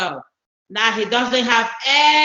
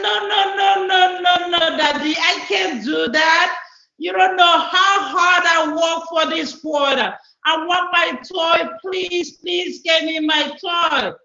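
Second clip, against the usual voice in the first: put-on pleading little boy; 5 semitones higher than usual. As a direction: cry out no like a kid about to lose his prize. Boy refuses, begs daddy.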